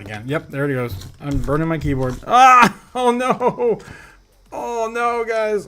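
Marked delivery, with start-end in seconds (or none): 2.3-2.8 sudden loud alarmed yell, pitched up; short real cry of alarm; startled yell. Real, not acted. Burning his keyboard on stream. 2.9-5.7 alarmed oh no. Real, not acted. Keyboard catching fire.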